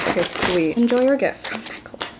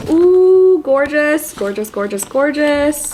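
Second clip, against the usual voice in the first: excited admiration; 5 semitones higher, 5 dB louder, slower than usual. Real, not acted. Admiring book.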